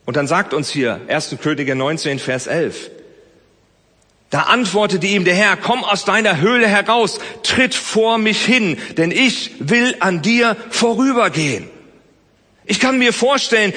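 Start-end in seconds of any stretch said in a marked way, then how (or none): 4.3-11.7 scripture reading. Acted. Reading Bible verse aloud.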